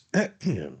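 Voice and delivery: solemn, self-important little cough; dignified throat-clearing. A narrator's reading. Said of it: "a solemn and dignified cough".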